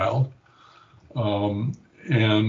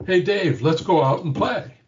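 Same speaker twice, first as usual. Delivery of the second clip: lightly voiced happy little girl, higher; a hint of a bright child inviting grandpa to play; cheerful little girl; 6 semitones higher than usual. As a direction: grandfather mimics a smiling granddaughter, a touch higher. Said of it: "a different Sally looked at me with a smile on her face".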